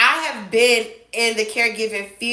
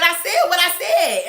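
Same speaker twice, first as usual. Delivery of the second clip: higher-pitched, defiant retold comeback; insistent retort; 7 semitones higher than usual. Employee asked by supervisor if she is sure, insistent. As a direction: stand your ground, pitch raised.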